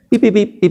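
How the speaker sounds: mock silly babble. Priest mimics the demon saying nonsense, high mock piping. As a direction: squeak out foolish syllables like a mocking demon. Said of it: "dice estupideces".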